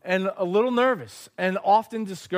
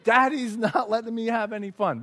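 put-on whiny child; 6 semitones higher than usual. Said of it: "he might think".

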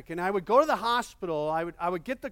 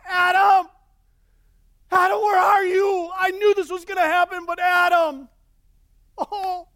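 much higher, much louder heartbroken shout; anguished cry; 11 semitones higher, 10 dB louder than usual. Retold or imitated. God calling for Adam with a heartbroken shout. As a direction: cry out desperately, pleading. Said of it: "his heart was coming out".

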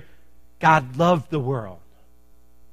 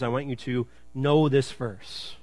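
normal talking voice

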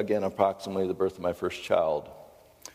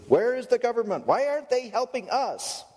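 helpless whining adults. Retold or imitated. Hurricane victims whining for the government to help. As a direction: whine like grown people acting like children.

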